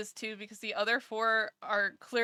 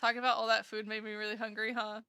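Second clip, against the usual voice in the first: fast muttered aside; rapid aside; faster than usual. Real, not acted. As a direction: rush through it.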